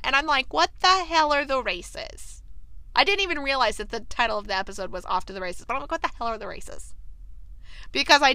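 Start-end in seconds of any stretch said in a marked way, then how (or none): 0.5-2.4 higher, slow, emphatic disbelief; exaggerated bafflement. Viewer reacting to a puzzling announcement, exaggerated bafflement. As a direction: drag it out, utterly baffled.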